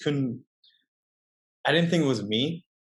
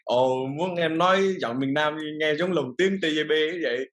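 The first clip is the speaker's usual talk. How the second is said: southern Vietnamese accent put on playfully, a bit higher, like an old dubbed martial arts movie; joking southern Vietnamese accent; 5 semitones higher than usual. A northerner showing friends his one joke line in a southern Vietnamese accent. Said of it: "I only do it, like, jokingly".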